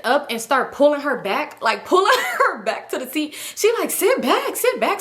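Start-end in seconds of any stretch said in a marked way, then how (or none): 3.9-5.0 high, urgent mock command; insistent high-pitched ordering; imitated bossy order. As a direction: order her back, imitating a fed-up friend. Imitates friend pulling her back.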